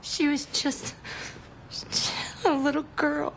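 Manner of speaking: high, halting sorrow; grief-stricken. Acted. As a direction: break up with grief, pausing mid-sentence. Grieving a girl not saved.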